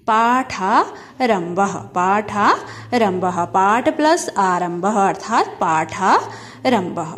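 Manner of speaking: पाठारंभः drawn out syllable by syllable; slow pronunciation. Shown as a demonstration. Teacher to class, pronouncing पाठारंभः slowly. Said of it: "पूरी संधि क्या हो जाएगी?".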